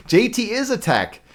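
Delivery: said through a laugh, high and slowed. Real, not acted. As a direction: giggle out the words "J T is a tech".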